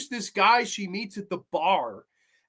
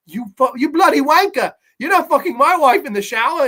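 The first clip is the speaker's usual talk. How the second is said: loud, high British outrage; hammed-up British cursing; bad British accent; 7 semitones higher, 8 dB louder than usual. Husband catches wife's lover, mock-furious in a British accent. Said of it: "his kind of reactions to everything".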